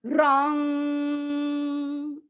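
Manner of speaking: रॉन्ग drawn out long, chanted evenly; full length. Shown as a demonstration. Teacher chanting रॉन्ग at full length. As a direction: give रॉन्ग its full time. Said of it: "उसको भी पूरा time देना है".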